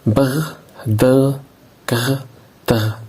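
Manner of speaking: teacher sounding out the clusters brr, drr, grr, drr; rolled consonant clusters. Shown as a demonstration. Teacher models letter sounds for pupils, rolled and buzzing. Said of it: "J'écoute et je repère".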